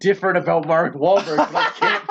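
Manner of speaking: high, helpless laughing storytelling. Real, not acted. Telling a friend about the actor mix-up, laughing. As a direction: tell it through laughter, pitch climbing.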